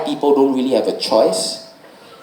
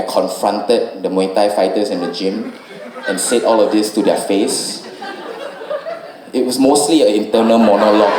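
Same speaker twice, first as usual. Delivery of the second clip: usual speaking voice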